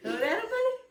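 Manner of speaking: teasing. Real, not acted. Mother teasing son about crying.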